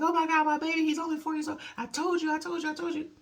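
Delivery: retold high-pitched maternal panic; freaking out. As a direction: imitate a mother frantic over her bleeding son. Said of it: "Freaking out".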